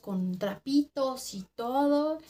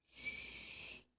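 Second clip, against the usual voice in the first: hushing shh; 14 dB quieter than usual. Retold or imitated. Omar hushing her in the dark.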